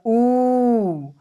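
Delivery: ऊ stretched slowly into a long vowel. Shown as a demonstration. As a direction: hold ऊ out long. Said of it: "अगर कोई ऊ बोल रहा है".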